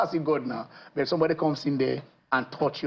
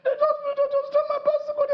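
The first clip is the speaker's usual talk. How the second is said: high, whimpering child imitation; hammed-up wail, pitched way up; mock crying boy. Crying boy tells policeman of theft, mock sobbing. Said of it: "he was somewhere crying".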